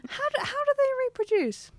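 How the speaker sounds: very high, giggly puzzlement; squeaky-high, barely holding back real laughter; laughing question. Real, not acted. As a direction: ask it through helpless giggles. Giggling over silly mermaid question.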